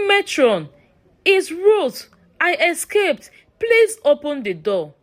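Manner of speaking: put-on high, pleading girl's voice at the door; a creature faking a frightened schoolgirl; mimicking child's plea. A narrator's reading. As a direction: beg to be let in, sounding like a lost girl.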